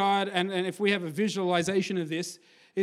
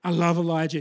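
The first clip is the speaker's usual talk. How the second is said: ordinary delivery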